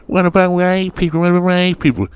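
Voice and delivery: high, mocking nagging voice; mocking whiny critic. Mimicking degenerates who keep yelling at him, mocking. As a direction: parrot the critics in a silly high voice. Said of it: "running around yelling that".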